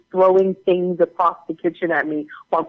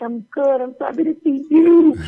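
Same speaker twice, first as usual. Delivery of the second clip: high gushing squeal, louder at the end; delighted greeting; 9 semitones higher, 4 dB louder than usual. Retold or imitated. Actress greeting her co-star at the premiere, squealing delight. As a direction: gush it, rising to a squeal.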